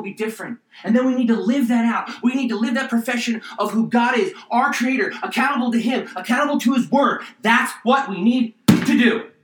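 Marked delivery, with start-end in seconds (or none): 0.8-7.2 louder, higher, fiery preaching at the congregation; preacher building to a loud climax; pumped-up preaching. Real, not acted. Preacher to congregation, getting pumped up and loud. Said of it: "Got a little pumped up there". 7.4-9.3 louder, higher and slower, driving it home; pumped-up shout. Real, not acted. Preacher to congregation, at a pumped-up peak. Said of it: "Got a little pumped up there".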